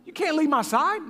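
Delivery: mock telling-off with a jump on the first word; scolding. Father re-enacts scolding his lost little son, high-pitched reprimand. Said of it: "first thing I did was scold him".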